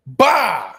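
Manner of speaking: short loud "bah" bang sound; mock gunshot. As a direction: make a gun going off with your mouth. Mock gunshot after the quote.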